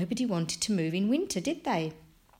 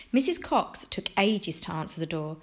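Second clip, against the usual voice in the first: ordinary delivery, nothing marked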